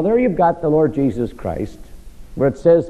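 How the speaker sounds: usual speaking voice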